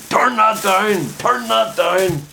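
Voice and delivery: high, loud shout of an annoyed dad. Retold or imitated. Dad yelling at his son to turn the music down. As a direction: bark it like a fed-up father. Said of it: "my dad will be shouting".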